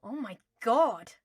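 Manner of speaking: slow, loud disgusted spit; spat. Acted. Draco recoiling at newborn owlets, spitting it out. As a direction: spit it out in distaste. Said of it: "Draco spat".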